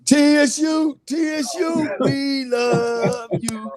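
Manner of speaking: fan cheer. Real, not acted. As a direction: chant for your school like a rowdy fan. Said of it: "Oh, Lord".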